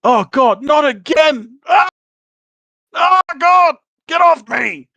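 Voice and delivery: high, loud, flustered shouting; acted exasperated holler; hollering in alarm. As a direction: yell it like you're fighting something off. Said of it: "and you can hear".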